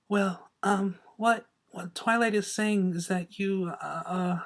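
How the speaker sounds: soft, shy, stumbling over words; nervous stammer. A narrator's reading. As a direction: stammer it out, timid. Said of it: "Fluttershy stammered".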